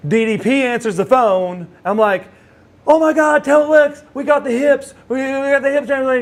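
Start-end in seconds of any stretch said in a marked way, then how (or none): 2.9-6.2 high-pitched, breathless rush of good news; excited announcement. Retold or imitated. Caller telling Lex's friend they got the hips, excited. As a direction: burst out with thrilling news, loud and high.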